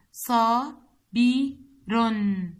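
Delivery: صا بي رن split into slow separate beats; syllable by syllable. Shown as a demonstration. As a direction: chop صا بي رن apart slowly. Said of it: "تحليل كلمة صابر هو".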